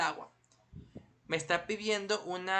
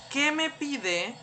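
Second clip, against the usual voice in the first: slow and higher, asking "¿qué me pide?"; activity voice; 7 semitones higher, 4 dB louder, much slower than usual. Retold or imitated. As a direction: draw the question out like an app prompt. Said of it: "donde va a decir". (pregunta lenta y aguda)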